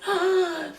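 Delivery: sharp high gasp with a cry; frightened gasp. Retold or imitated. Hears mom's croaky voice.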